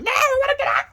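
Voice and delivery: shrieking, high-pitched cry to be let out; piercing, panicked wail to get out; trapped thing screaming. Pretend. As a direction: yell like something desperate to break free of your chest. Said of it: "And this thing is like".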